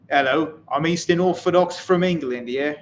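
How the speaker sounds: mock British accent, lightly done; light imitation of an English accent. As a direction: put on an English accent for the viewer. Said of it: "I went to a British school, so it's not cultural appropriation if I do that".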